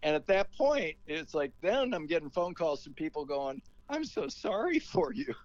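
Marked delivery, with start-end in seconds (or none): 3.9-5.3 much higher, slow sympathetic coo; mock pity. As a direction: coo it like pitying callers. Callers pitying the American.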